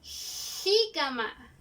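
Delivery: "Jícama" stretched very slowly, throaty "j"; slow word demo. Shown as a demonstration. Teacher to pupils, drawing out a J word. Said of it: "sonido en la garganta".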